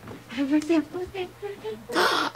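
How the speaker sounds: high drawn-out gasp; excited gasp. Real, not acted. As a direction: gasp in delighted recognition. Gasp on recognising an actress.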